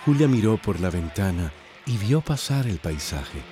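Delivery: ordinary talk, nothing marked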